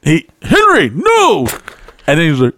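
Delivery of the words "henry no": calling out. Retold or imitated. Friend Frank calling Henry off.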